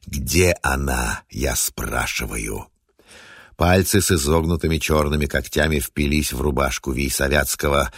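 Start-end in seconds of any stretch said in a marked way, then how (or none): 0.0-2.7 low, slow, eerie otherworldly voice; slow, menacing ghost from ages past; inhuman ancient voice. Acted. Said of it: "далёкий, страшный, нечеловеческий".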